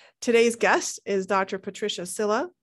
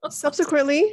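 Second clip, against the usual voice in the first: laughing; 7 semitones higher, 6 dB louder than usual. Real, not acted. Host laughs while reading bio.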